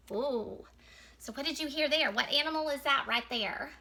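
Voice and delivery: ordinary talk, nothing marked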